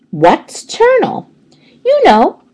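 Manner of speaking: put-on chirpy high child voice; eager schoolgirl Heidi. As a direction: pipe up like a know-it-all kid. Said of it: "said Heidi".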